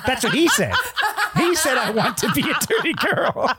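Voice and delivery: high, laughing reread. Real, not acted. Host rereading the AI's line, high laughing. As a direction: giggle through the quote.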